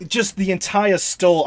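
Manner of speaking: normal talking voice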